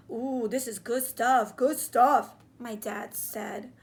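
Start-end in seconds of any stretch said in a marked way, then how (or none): 0.1-2.3 loud, delighted savoring; relishing dad. Acted. Dad praising the enemy pie to the boys. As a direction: smack your lips over it.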